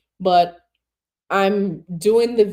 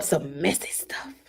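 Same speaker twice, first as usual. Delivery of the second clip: whisper; 7 semitones lower, 8 dB quieter than usual. Retold or imitated. Reading chat comment in whisper.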